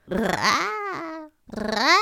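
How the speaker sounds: loud crow caws; raspy cawing crow. Acted. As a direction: caw like a crow.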